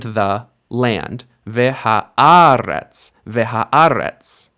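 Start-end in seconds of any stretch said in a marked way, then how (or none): 1.5-3.1 veha AH-retz, slow and loud on "a"; stress on the stem. Shown as a demonstration. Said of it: "The stress stays on the first letter of the stem". 3.3-4.5 veha AH-retz, beat on "a" of aretz; stress on the stem. Shown as a demonstration. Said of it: "The stress stays on the first letter of the stem".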